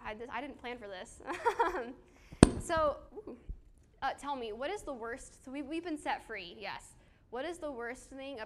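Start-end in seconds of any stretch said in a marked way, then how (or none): 1.2-2.5 emotional laugh. Real, not acted. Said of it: "I don't know why I'm getting emotional".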